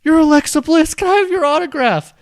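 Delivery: squealing starstruck fan, very high; bad acting. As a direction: gush like a hammy extra. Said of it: "Worst acting ever".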